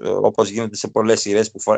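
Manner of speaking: ordinary talk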